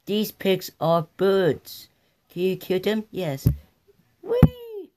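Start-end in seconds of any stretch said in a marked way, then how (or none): 4.2-4.9 high gleeful whee. Acted. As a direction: fling it out high. Bird launched in game.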